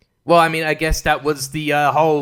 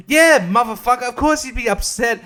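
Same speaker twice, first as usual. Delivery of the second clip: sarcastic outburst; 8 semitones higher, 3 dB louder than usual. Real, not acted.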